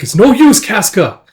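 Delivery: high, loud despairing call; urgent warning. Acted. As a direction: shout an acted warning.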